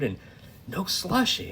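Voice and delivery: ordinary talk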